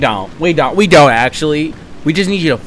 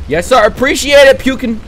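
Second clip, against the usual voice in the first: playful thanks; 9 semitones higher, 4 dB louder than usual. Pretend. Thanking in-game character.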